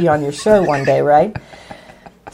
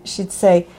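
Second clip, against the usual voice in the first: usual speaking voice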